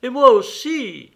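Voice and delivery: put-on high, loud chime-in from another child; excited acted shout; eager cry. A narrator's reading. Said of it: "s'écria un autre".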